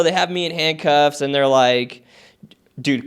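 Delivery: usual speaking voice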